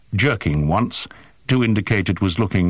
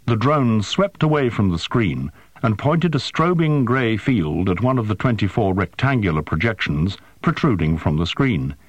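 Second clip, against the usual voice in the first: usual speaking voice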